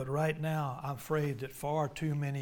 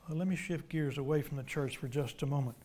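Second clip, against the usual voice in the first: ordinary talk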